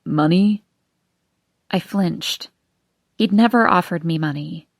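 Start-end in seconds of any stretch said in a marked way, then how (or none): 0.0-0.6 trying to sound natural; forced casual. Acted. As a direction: ask it with forced ease. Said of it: "tried to make his voice seem natural".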